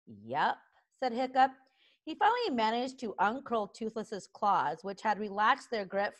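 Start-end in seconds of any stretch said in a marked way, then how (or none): 0.0-0.6 low, soft one-word admission; resigned. Acted. Hiccup admitting he has problems, quiet resignation. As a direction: concede it quietly.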